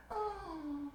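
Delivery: tiny high, soft "oh"; stricken date. Retold or imitated. Date hears the tragic story, imitated in a small high voice. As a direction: squeak a mock-sympathetic reaction.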